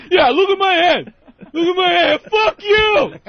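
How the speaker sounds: high, loud enraged yelling, imitated; losing it. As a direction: scream back like a man pushed too far. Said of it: "this fucking guy is just losing".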